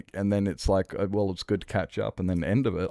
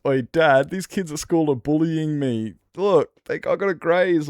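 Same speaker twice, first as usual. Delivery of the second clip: put-on high, loud whiny kid voice, much higher than his own; kid in a Family Guy skit; 9 semitones higher, 7 dB louder than usual. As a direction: play the bullied kid complaining to his dad. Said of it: "and he goes".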